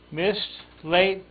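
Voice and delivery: ordinary delivery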